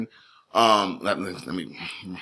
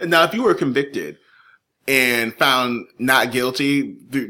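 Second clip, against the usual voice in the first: usual speaking voice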